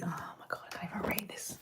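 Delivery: unvoiced whisper to herself. Real, not acted. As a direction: whisper it under your breath. Can't read the river name.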